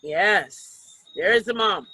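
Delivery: ordinary delivery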